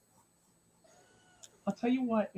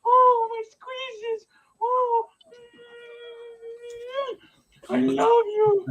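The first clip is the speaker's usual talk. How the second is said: very high, loud, squealed adoration; gushing baby talk; 13 semitones higher, 7 dB louder, much slower than usual. Pretend. Dad hugging his kitten of a daughter, mock-gushing squeal. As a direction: coo at full volume mid-squeeze.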